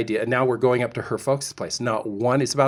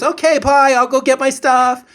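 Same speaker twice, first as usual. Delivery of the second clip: high, loud, breezy brush-off; chirpy date; 13 semitones higher, 9 dB louder than usual. Retold or imitated. Husband imitating his date, high and chirpy. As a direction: chirp it cheerfully and walk away. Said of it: "She goes".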